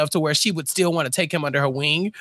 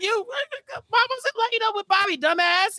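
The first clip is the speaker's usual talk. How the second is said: mock blurting yell; 13 semitones higher than usual. Harvey voiced blurting truth.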